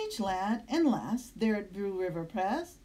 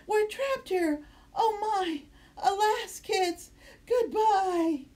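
high, loud hammed-up panic; panicked scream; 7 semitones higher, 3 dB louder, slower than usual. A narrator's reading. Teacher stranded on the island, shrieking in panic. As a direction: shriek like the world is ending. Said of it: "started to scream".

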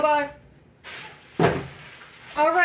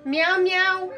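high, sing-song meow; imitated cat meow; 5 semitones higher than usual. Trying out the interactive toy cat, imitated meow. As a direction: coax a pet with a pitched-up meow.

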